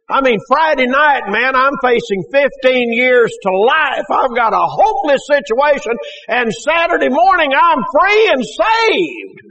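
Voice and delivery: high, building to a long-drawn cry; jubilant testimony. Real, not acted. Preacher testifying he went from prison to saved, jubilant build. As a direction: build in triumph and stretch the final words out.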